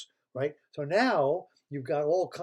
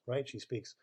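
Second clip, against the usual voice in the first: ordinary delivery, nothing marked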